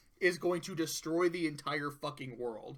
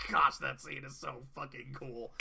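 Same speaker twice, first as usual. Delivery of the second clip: ordinary talk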